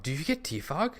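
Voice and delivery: high rising question on Defog. Real, not acted. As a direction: ask it hopefully, pitch climbing to the end. Searching his team for Defog.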